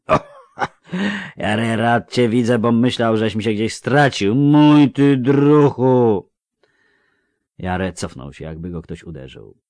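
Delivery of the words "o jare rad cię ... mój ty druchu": loud, higher, slurring tipsy villager; drunk Melfi. Acted. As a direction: call out like a cheery drunk. Drunk Melfi greets friend. (pijany Melfi, głośno i bełkotliwie)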